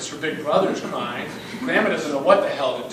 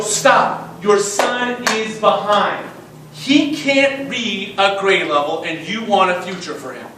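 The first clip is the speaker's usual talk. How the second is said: loud, raised pitch, slow emphatic words; forceful confrontation; 4 semitones higher, 7 dB louder, slower than usual. Retold or imitated. Principal telling dad his son is behind, forcefully. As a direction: cut him off and drive each word home.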